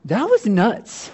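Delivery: high, slowly dawning amazement; a hint of stunned hindsight; dawning realization. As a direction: look back and shake your head. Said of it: "You go".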